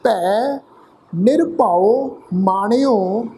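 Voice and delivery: slow, careful gurbani recitation, syllable by syllable. Shown as a demonstration. Teacher reciting scripture to learners, slow and deliberate. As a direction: recite each word slowly for the students. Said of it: "ਇੱਕ ਵਾਰ ਫਿਰ ਤੋਂ ਪਾਠ ਉਚਾਰਨ ਕਰ ਰਹੇ ਹਾਂ".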